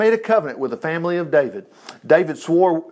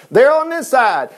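raised, carrying call; 6 semitones higher, 3 dB louder than usual. Acted. Jonathan to the kid fetching arrows, calling out. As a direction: call across a field, pitched up.

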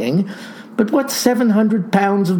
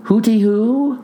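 calling hooty-hoo; 6 semitones higher than usual. A narrator's reading. Captain calls to the dazed boy, sing-song. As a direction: call it out to check he's awake. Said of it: "tried-and-true trick to assess".

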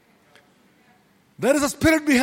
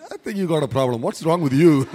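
mock congregation, laughing; 8 semitones lower than usual. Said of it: "You all look like".

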